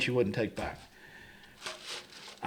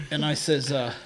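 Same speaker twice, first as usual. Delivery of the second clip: ordinary talk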